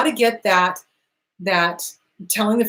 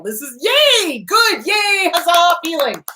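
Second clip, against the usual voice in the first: high, drawn-out yay and huzzah; playful cheering, pitched way up; mock celebration; 8 semitones higher, slower than usual. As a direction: celebrate feelings like a party. Therapist cheering a client on.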